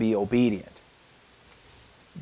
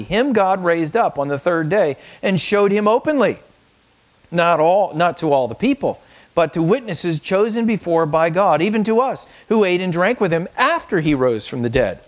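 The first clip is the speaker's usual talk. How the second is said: raised, proclaiming scripture voice; scripture reading; 4 semitones higher than usual. Acted. Pastor reading scripture aloud to the congregation, proclaiming. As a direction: read the verse out as a proclamation.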